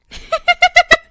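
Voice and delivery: a burst of loud, high, triumphant laughter; gleeful demon laugh. Acted. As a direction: cackle with joy at being free. Demon freed from talisman.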